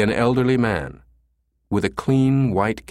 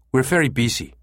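a snappish clerk, slightly higher; snapped; 5 semitones higher than usual. A narrator's reading. Busy clinic clerk refusing a caller, snappish. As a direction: brush the caller off curtly. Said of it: "the woman snapped".

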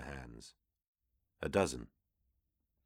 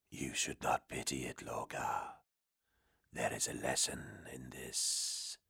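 low, breathy whispering demon; 6 semitones lower, 9 dB quieter than usual. Acted. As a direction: hiss it like a hidden demon. Demon speaks behind him.

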